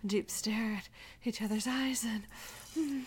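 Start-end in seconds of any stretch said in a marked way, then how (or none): none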